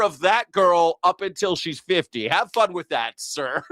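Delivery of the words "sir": normal talking voice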